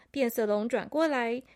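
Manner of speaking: plain reading voice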